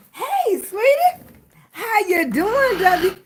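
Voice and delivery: high, sweet southern country greeting; southern accent, greet a friend warmly. Pretend. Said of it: "Look, I sound country".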